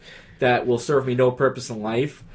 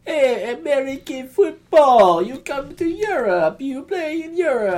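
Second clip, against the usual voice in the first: high, drawn-out European caricature; slow, lilting put-on foreigner pitched way up; mock European; 13 semitones higher, slower than usual. Mimicking Europeans mocking American football.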